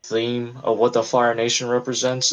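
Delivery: ordinary delivery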